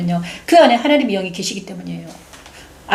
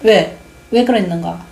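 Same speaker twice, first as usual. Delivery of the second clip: ordinary talk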